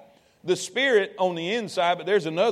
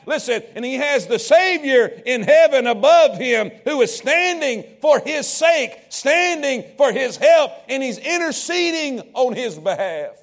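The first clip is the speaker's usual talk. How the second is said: high, loud sermon peak; full-force preaching; 5 semitones higher, 7 dB louder than usual. Acted. Preacher crying out to congregation, loud and high.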